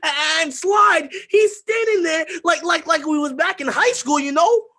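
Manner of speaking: put-on teen confessing the beating, frantic shout; frantic retelling. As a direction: spill it out, spiralling out of control. Retelling beating, rising frenzy.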